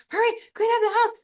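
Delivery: high, loud and fast hammed-up panic; mock frantic mom. Stressed mom rushing the kids before dad comes, in a fast mock panic. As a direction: rush the kids like mom is freaking out. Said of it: "mom starts freaking out and like".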